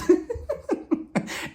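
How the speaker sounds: high breathy cackle; amused squeal; high laugh. Real, not acted. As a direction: laugh at the chaos. Laughing at Quay running out.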